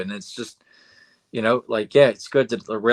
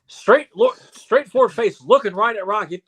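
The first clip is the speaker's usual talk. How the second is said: ordinary talk, nothing marked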